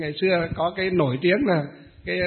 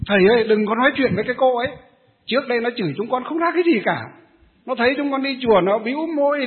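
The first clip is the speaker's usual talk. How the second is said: higher, louder, fast and aggrieved, telling on her; indignant complaint; 5 semitones higher, 4 dB louder than usual. Retold or imitated. Old temple women complain to the monk, indignant and fast. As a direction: pour out the grievance to the monk in a rush. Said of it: "bà bái trưởng và bái hộ còn nói với thầy gì?".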